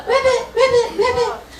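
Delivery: high squeaky ribbits; baby frog croak. Acted. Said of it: "Baby Ribbit says".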